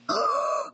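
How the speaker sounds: sharp audible gasp. Real, not acted. Player reacting to the game, gasp.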